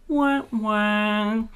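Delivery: mock sad-trombone "wah, wah"; drawn-out comic womp-womp; sad trombone imitation. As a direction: sound a comic letdown. Sad-trombone sting after bad news.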